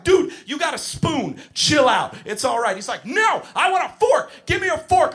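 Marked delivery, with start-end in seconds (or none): 0.0-2.7 raised, exasperated teacher voice; losing it. Acted. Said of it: "I just wanna scream at him". 3.1-5.1 put-on high-pitched toddler tantrum yell; screaming three-year-old. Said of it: "I'm being screamed at point blank".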